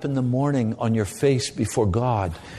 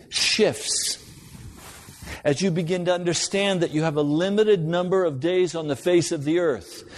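raised, urgent pulpit voice, pitch higher; impassioned preaching; 6 semitones higher than usual. Acted. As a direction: preach it with rising fervor, higher. Preaching builds to warning.

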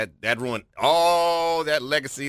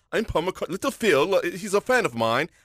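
higher-pitched mock English accent, condescending air; 3 semitones higher than usual. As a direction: put on a lightly snobbish English accent. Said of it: "a heavy English accent".

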